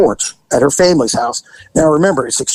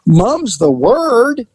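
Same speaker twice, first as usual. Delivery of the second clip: mock promise; 6 semitones higher, much slower than usual. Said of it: "She goes".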